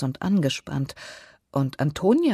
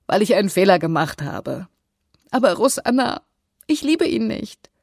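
distraught plea, rising high and louder; tearful protest; 5 semitones higher, 5 dB louder than usual. A narrator's reading. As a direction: wail it to your sister in acted despair. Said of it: "Um ihr Schluchzen zu dämpfen". (verzweifelt klagend, immer höher)

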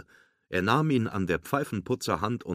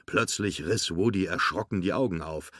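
usual speaking voice

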